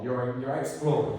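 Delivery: ordinary delivery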